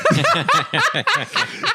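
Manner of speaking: a high squealing burst of laughter. Real, not acted. Retelling the Chappelle story, a high laughing squeal. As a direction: let out a high, helpless laugh.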